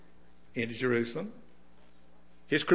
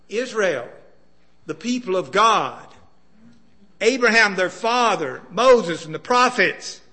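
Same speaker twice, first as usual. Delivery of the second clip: louder and higher, rising preaching fervour; full-force preaching; 6 semitones higher, 9 dB louder than usual. Acted. Preacher listing all God invested in Israel, full-force preaching. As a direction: build it up like a pulpit climax.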